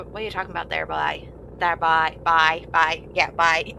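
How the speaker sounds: quick demo of a Newfie accent. Shown as a demonstration. Showing the local kind of accent, Newfie accent. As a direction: toss off a few words in broad Newfie. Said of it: "almost a Newfie accent".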